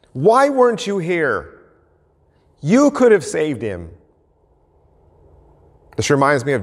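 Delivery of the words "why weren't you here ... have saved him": mildly raised, accusing cry; a hint of an angry, grieving sister; aggressive. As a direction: accuse him while pounding his chest. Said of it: "seems a little bit more aggressive".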